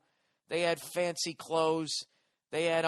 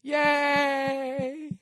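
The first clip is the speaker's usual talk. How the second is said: high, loud, empty cheer; high squealed Yay; mock ditzy cheer; 11 semitones higher, 6 dB louder than usual. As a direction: mimic a clueless fan cheering on cue. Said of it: "And she just goes".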